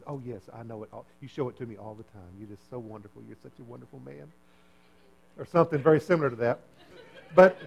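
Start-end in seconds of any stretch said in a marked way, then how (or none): 0.1-4.3 a hint of the wife, much softer and lower; lightly voiced wife, gushing softly; murmured, mock-adoring wife; wife's answer. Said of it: "she says".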